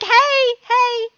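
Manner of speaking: high, loud imitated hello; mock party greeting. Party hoppers greeting the startled host, high and loud. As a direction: call out an over-cheery hey to strangers.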